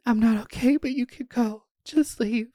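high, strained pleading; panicking woman sending her friend away, high strained voice; shaky panic. Retold or imitated. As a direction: sound on the edge of tears. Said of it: "And I'm like".

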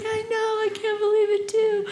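high, giddy gushing; excited celebration. Retold or imitated. Girl celebrates with her crowd of supporters, excited. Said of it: "it was so exciting".

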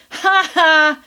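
big hearty mock laugh; loud laugh. A narrator's reading. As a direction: boom out a prankster's laugh. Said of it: "his growlings changed to a loud".

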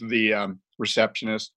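ordinary talk

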